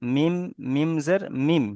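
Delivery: slow, sing-song "মিম, মিম জের মিম"; spelling-out demo. Shown as a demonstration. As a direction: stretch each "মিম", ending on a closed m. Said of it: "এখানে উপর সাকিন বসালে".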